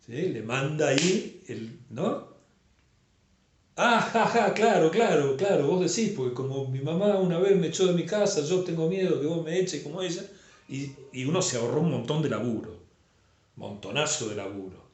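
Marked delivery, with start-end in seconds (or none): 3.8-10.2 risa burlona y fuerte, más aguda; terapeuta canchero. Acted. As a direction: suelta la interpretación riéndose, sobrado. Terapeuta canchero interpreta riendo. (terapeuta sobrado que se ríe del paciente)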